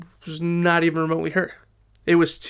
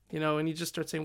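normal talking voice

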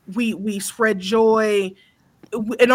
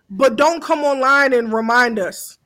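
ordinary talk

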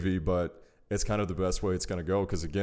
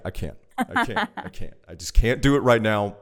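exasperated; 3 semitones higher, 5 dB louder than usual. Retold or imitated. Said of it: "where it's like".

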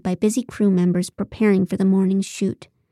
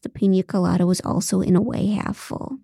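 ordinary delivery, nothing marked

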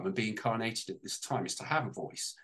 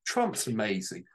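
usual speaking voice

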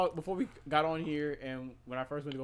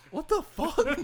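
high, louder shocked woman voice; imitating her; 14 semitones higher, 9 dB louder, much slower than usual. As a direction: squeak it like she's appalled. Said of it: "And she was like".

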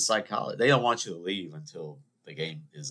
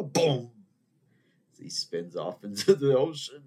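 ordinary talk

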